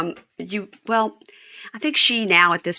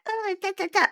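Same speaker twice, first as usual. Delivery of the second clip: put-on high breathy baby talk; squeaky little-girl voice; 4 dB quieter than usual. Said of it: "[name] baby voice".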